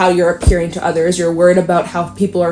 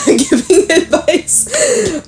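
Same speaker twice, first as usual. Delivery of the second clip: laughing; 10 semitones higher, much slower than usual. Real, not acted. Laughing at herself.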